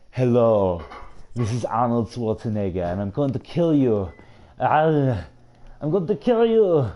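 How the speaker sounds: loud action-star impression with Austrian accent; Arnold Schwarzenegger. Shown as a demonstration. Streamer demonstrating his impressions for viewers, loud Schwarzenegger impression. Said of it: "then there's always Arnold Schwarzenegger".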